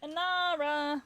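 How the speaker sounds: calling out. Real, not acted. Calling out a character's name.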